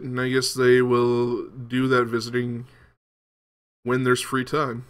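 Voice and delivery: ordinary delivery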